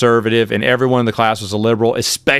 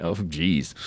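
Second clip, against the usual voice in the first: sheepish geez; 7 semitones lower, 11 dB quieter than usual. Retold or imitated. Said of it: "And I was like".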